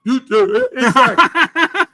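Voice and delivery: laughing, high and loud agreement; slow, laughing exactly. Real, not acted. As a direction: crack up while agreeing.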